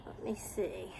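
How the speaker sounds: soft whisper to herself; hushed whisper. Real, not acted. Whispering while handling animal.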